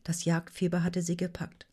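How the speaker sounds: usual speaking voice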